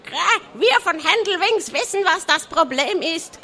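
high, excited clucking voice; cackling hen. A narrator's reading. The hen cackling eagerly to the pirates. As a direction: squawk it like a fussy hen. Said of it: "gackerte das Huhn aufgeregt".

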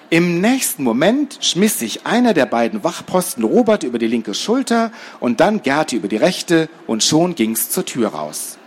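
plain reading voice

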